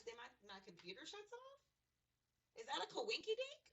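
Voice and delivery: high, loud sarcastic question; rhetorical, daring anyone to call it chance; sarcastic disbelief to the viewers; mock-incredulous. Real, not acted.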